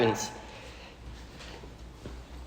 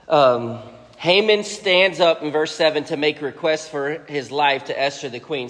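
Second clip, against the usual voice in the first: ordinary talk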